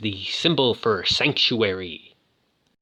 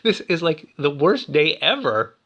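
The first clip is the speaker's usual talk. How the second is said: raised-pitch retold panic; mock confused dismay; 5 semitones higher than usual. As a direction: play up his bewildered dismay. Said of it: "he's all, like, confused".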